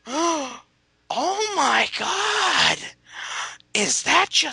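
high, very slow, drawn-out gasp; mock old lady gasp. Old churchgoers mistaking him for dad, high gasp.

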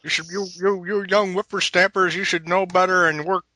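put-on high cranky old man voice; old prospector. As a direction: scold like a crotchety old geezer.

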